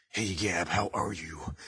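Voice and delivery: low, soft character reply; put-on character voice. Second character greeting Gab, low put-on voice. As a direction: drop into a low, quiet comic voice. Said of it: "I can talk Gab".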